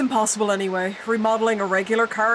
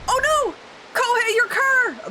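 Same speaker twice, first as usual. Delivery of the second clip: alarmed cry; 14 semitones higher than usual. Acted. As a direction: wail over the crushed car. Tree falls on the car.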